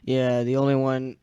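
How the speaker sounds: usual speaking voice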